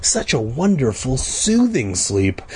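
usual speaking voice

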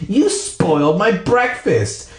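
high, drawn-out angry complaint; angry cry. A narrator's reading. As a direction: cry out in acted outrage. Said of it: "Anne cried angrily".

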